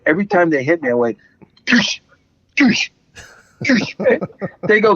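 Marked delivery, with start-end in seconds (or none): 1.6-3.9 sharp high "toosh" grunts, one per punch; retold fighting shouts, lightly done; imitating martial arts kiai. Actor mimics his on-set punch grunts for the host. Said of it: "with every violent action, you release, uh, a sound".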